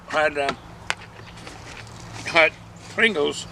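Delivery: talking with a mouthful; chewing while speaking; mouth full. Real, not acted. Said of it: "I don't mean to talk my mouth full".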